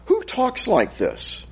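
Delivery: high, slow, disbelieving put-on question; mock incredulous. Preacher asking the congregation who talks like this, mock incredulous. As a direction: ask it like you can't believe anyone talks this way. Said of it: "I want to ask now".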